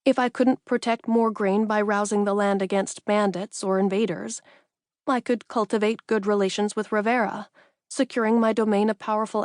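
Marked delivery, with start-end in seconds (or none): none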